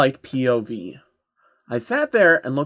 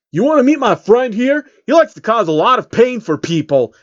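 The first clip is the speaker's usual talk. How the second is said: higher, louder tough-guy taunt; louder mock gangster threat; menacing threat; 8 semitones higher, 6 dB louder than usual. Rapper threatens pony with gun.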